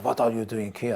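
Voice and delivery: usual speaking voice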